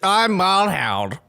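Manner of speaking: slow, drawn-out mock drawl; silly drawl intro. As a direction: stretch the name out lazily. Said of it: "How you start".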